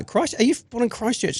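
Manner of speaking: high, soft incredulous question; suddenly high and hushed, disbelieving; surprised. Real, not acted. Surprised at birthplace.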